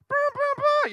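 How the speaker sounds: high, loud booming blasts; real loud. Retold or imitated. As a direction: blast it out like an air horn. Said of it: "you know, real loud".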